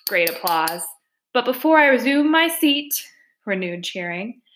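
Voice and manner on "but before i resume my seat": higher-pitched after-dinner speaker; banquet speechmaker. Acted. Weasel addressing the cheering banquet, high speechmaker voice. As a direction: address a banquet hall in a raised character voice.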